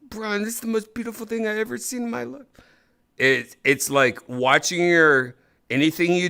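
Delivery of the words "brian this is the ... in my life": mock tearful awe. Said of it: "you're gonna be like".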